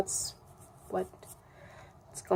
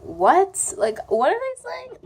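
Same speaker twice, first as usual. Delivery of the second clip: confused; 11 semitones higher, 4 dB louder than usual. Retold or imitated. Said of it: "I'm like".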